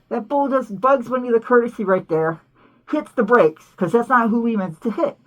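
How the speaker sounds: usual speaking voice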